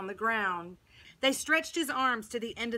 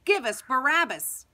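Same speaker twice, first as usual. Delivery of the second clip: loud, higher demand; crowd shouting; 4 semitones higher, 8 dB louder than usual. A narrator's reading. People answer Pilate immediately, shouting. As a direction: shout it as one insistent crowd. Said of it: "the people shouted".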